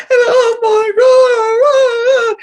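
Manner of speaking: high, very slow sobbing wail; crying. Retold or imitated. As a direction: wail it out through tears. Crying at the TV, retelling.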